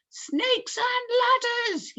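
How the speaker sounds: very high, slow drawn-out cry; dismayed yell. A narrator's reading. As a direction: cry out in despair. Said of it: "yelled the fire".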